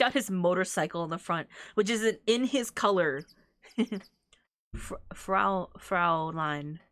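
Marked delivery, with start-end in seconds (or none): 4.8-6.8 "Fraulein" tried out haltingly, lightly German; "Fraulein" with a faint German accent, rehearsed. Shown as a demonstration. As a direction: stumble into "Fraulein" with a bit of an accent. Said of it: "I had to say it with, like, a bit of an accent".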